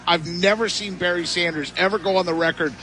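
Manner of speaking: ordinary talk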